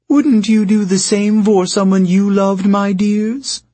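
high, coaxing, eyelash-batting plea; flirty. Acted. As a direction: charm them into agreeing. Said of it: "gave them a pitiful look, batting her eyelashes".

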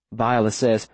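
usual speaking voice

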